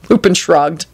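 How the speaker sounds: plain reading voice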